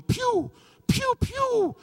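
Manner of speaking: shouted, very high pew pew sounds; gunshot noises. Retold or imitated. Acting out shooting people for the passengers with loud pew pews. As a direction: blast out cartoon gunfire.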